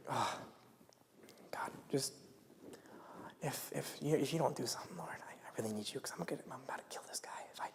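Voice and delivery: urgent whisper, speeding up; desperate whispered prayer. Retold or imitated. Teen praying not to lose it at someone, whispering fast. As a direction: whisper through clenched anger, racing. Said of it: "you're like".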